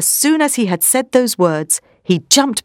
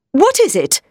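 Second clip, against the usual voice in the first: impatient question, pitched up; 8 semitones higher than usual. A narrator's reading. Professor interrupted while counting, impatient. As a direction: ask it with a touch of irritation, higher. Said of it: "Van Helsing asked impatiently".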